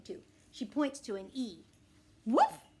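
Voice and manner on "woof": high, loud single woof; dog bark. Acted. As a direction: bark instead of answering. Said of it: "I say".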